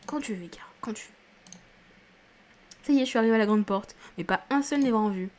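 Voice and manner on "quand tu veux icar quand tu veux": répété dans un souffle; aparté chuchoté. Real, not acted. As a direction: chuchoter l'invitation au personnage. Aparté séducteur à un personnage. (chuchotement complice qui s'éteint)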